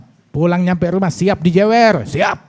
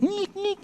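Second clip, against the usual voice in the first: high, soft squeaky ngik ngik; imitated squeals; 9 dB quieter than usual. Preacher imitating screams heard behind Umar's door. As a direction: mimic faint shrill screams from inside. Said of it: "ada suara jerit-jerit".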